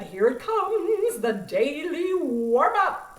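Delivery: slow, loud announcer build-up; teacher introducing the coaches' warm-up; announcer reveal. Acted. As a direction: present it like a show host. Announcing the surprise coach segment.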